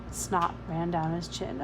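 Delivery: ordinary delivery, nothing marked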